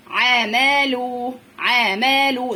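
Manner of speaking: عملوا in even held syllables, no break; teacher sounding out each syllable of عملوا; each vowel separate. Shown as a demonstration. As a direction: chant عملوا vowel after vowel. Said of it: "ما توقفش".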